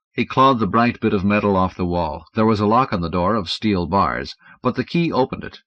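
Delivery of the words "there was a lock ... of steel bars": plain reading voice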